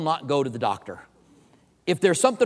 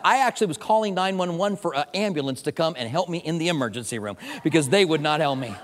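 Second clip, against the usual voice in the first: ordinary talk, nothing marked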